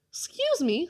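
high, courteous little interjection; polite. A narrator's reading. Caleb to the tramp while pushing past him, polite. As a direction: politely pipe up, pitched high. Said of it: "said Caleb politely".

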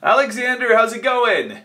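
upbeat phone greeting. Real, not acted.

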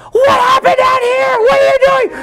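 high, loud panicked mother's yell; frantic mom. Retold or imitated. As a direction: shriek it like a mom who just saw blood. Mom bursts out, alarmed.

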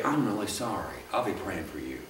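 quiet comforting words for someone hurting; sympathy. Shown as a demonstration. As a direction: comfort them gently and quietly.

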